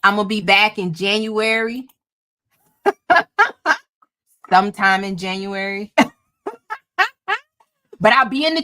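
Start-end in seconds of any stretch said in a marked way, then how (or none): 2.8-3.8 high, loud burst of laughter. Real, not acted. Host promising viewers a January return, laughing. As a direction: laugh out in short pealing bursts.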